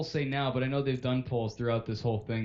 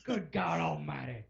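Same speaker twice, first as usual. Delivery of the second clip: slow, softened commentator impression; hushed mock horror at ringside, drawn out; Jim Ross; 2 semitones higher, 7 dB quieter, slower than usual. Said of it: "Jim Ross impression".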